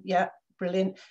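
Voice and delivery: usual speaking voice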